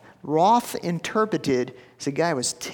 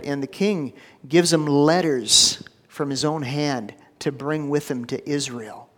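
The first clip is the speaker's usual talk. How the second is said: ordinary delivery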